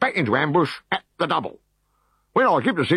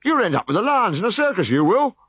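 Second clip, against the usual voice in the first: high, loud angry tirade; furious officer; 4 dB louder than usual. Acted. Decurion berating his legionaries, high and furious. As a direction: tear into the cowards.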